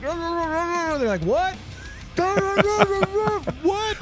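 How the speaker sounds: high, lightly mocked "what?"; mock confusion. Fans at a noisy game not hearing, in a lightly high mock "what?". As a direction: play someone who can't hear. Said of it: "And I'm like".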